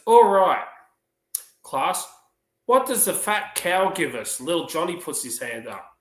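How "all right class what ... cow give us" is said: high, slow schoolteacher voice; high-pitched classroom questioning; teacher addressing class. Acted. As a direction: quiz the class, loud opener then drawn out. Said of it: "She goes".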